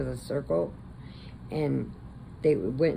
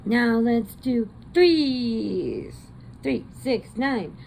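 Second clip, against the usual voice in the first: put-on high, slow little girl voice; proud toddler showing off her counting; higher, slow sing-song counting; 8 semitones higher, 5 dB louder, much slower than usual.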